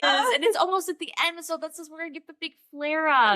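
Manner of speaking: mock cheerful explanation; exasperated reply. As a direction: answer brightly as if it were obvious. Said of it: "And I was like".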